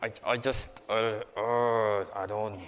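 shy person balking at outreach, reluctant groan. Retold or imitated. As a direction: shrink from the idea. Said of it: "normal people like me ... you're like".

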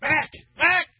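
high, loud, urgent nudging; excited friends. Retold or imitated. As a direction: tell your buddy he's been called. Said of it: "They said".

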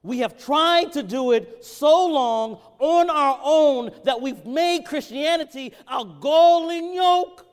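high, loud pulpit preaching; rising fervent sermon voice; preaching at full force. Acted. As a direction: preach it out, pleading with the crowd.

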